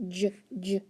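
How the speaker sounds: "J" as a short "juh", twice with a gap; letter sound J. Shown as a demonstration. Teacher quizzing pupils on spelling sounds, isolated letter sound. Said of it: "J sound".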